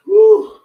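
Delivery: loud high exhale whoop; exhausted whoop. Real, not acted. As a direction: blow out a big whoop. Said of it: "That's like a workout".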